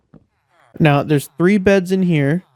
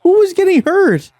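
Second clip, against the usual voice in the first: alarmed cry; 13 semitones higher, 4 dB louder than usual. Real, not acted. Villager suddenly hurt, alarmed.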